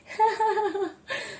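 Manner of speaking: high, light giggle; short burst of teasing laughter; amused laugh. Acted. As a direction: laugh lightly at a shy sister. Sister laughs at her shyness.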